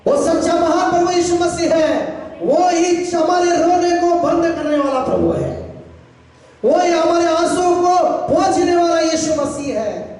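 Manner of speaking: high shouted proclamation; impassioned sermon cry on a sustained high pitch; full-force preaching. Real, not acted. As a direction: declare it to the whole congregation.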